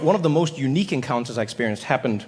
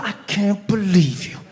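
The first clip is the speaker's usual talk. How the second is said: scornful Harriet; 4 semitones higher, slower than usual. Acted. Harriet scolding slowly.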